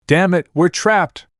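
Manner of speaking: slow, high-pitched cry of frustration; mild yell. A narrator's reading. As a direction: call out trapped, holding the volume back. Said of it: "Naruto yelled".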